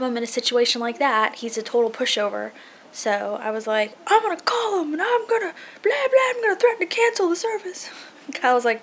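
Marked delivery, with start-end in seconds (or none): 4.1-7.9 high, whiny mock threat; mock outraged threat. Wife mocking her own threat to the cable company, high and whiny. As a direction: squeal it like a put-on tantrum.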